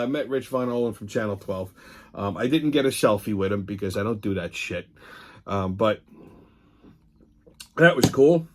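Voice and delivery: usual speaking voice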